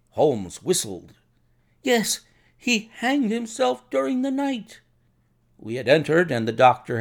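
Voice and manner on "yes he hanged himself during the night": high-pitched, agitated confirmation; distressed report. A narrator's reading. Shaken doctor confirming the hanging to Holmes, high and distressed. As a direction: confirm the awful news, voice raised in dismay.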